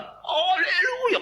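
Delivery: slow, high, booming haleluya like Tarzan; Tarzan-like yell. Retold or imitated. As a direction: bellow it out like a jungle yell. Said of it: "kayak Tarsan".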